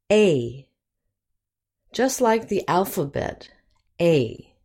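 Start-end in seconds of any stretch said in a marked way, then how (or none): 0.1-0.7 A said as "ay", like the letter's name; long A. Shown as a demonstration. Teacher modelling the long sound of A for learners, long A. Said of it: "long vowels have the same sound as". 4.0-4.5 A said exactly like its alphabet name, "ay"; long A. Shown as a demonstration. Teacher repeats the alphabet name for learners, long A. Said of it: "It's also the long sound".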